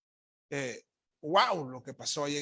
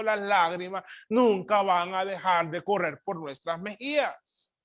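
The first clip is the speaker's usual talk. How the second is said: raised, insistent preacher's voice; emphatic preaching; 5 semitones higher, 8 dB louder than usual. Real, not acted. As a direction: preach it with building force. Preacher in full flow.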